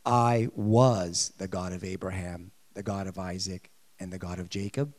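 booming God quoting Exodus, past tense; God speaking wrong tense. Retold or imitated. Preacher voicing God to congregation, deep and solemn. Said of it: "he didn't say this".

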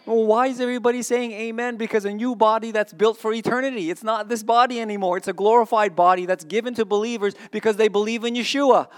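high, loud, building sermon voice; full-force preaching. Real, not acted. Preacher firing up the congregation, fervent. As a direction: preach it out to the whole hall.

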